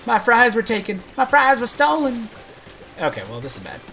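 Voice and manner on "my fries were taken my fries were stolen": louder, higher hammed-up wail; mock lament. Streamer mourning his stolen fries, hammed-up wail. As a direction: wail over the lost fries.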